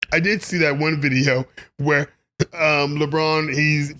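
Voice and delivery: ordinary talk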